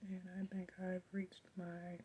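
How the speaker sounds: fading to a whisper from throat pain; strained whisper. Real, not acted. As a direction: voice giving out to a whisper. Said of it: "Talking after a while starts to hurt".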